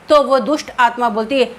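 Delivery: ordinary delivery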